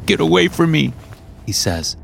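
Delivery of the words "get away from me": high tearful cry. A narrator's reading. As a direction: push them away through tears. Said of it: "tears streaming out of his eyes".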